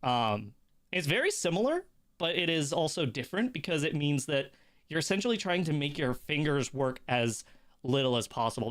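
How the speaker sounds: usual speaking voice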